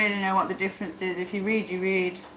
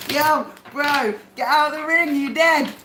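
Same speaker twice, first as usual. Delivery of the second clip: louder, raised call to the ring; mock calling out; 5 semitones higher, 6 dB louder than usual. Pretending to shout at Tyson to get out of the ring. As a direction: yell at the boxer to quit.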